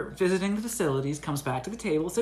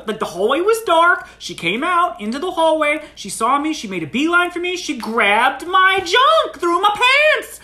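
high retold protest building to a shout; agitated insistence; 9 semitones higher, 5 dB louder than usual. Friend insists, retelling the assault.

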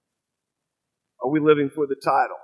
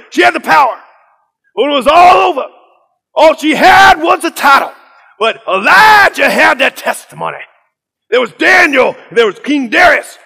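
Pastor Kevin Cosby; 13 semitones higher, 12 dB louder than usual. Retold or imitated. Pastor sweeping through the Bible for students, shouted preacher imitation.

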